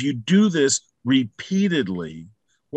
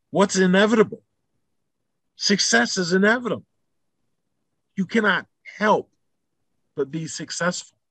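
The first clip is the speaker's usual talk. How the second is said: triumphant declaration; 7 semitones higher than usual. Real, not acted. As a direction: announce it like a sure victory. Climax of pep talk.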